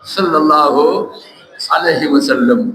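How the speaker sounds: low, measured salawat; intoned blessing. Real, not acted. Preacher blesses the Prophet after the story line, low intoning. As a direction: drop low and pause between halves.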